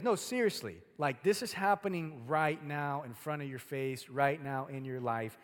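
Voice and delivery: loud, insistent warning; serious urgency. Shown as a demonstration. Preacher showing how Scripture speaks, urgent and insistent. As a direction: press it home as happening right now. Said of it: "No, it speaks like".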